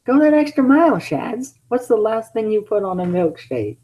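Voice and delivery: high teasing goad; Sonic goading voice. Acted. Sonic goading Shadow, high and teasing.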